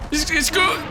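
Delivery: ordinary talk, nothing marked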